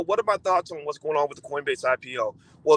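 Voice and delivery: usual speaking voice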